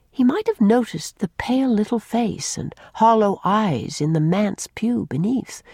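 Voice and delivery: usual speaking voice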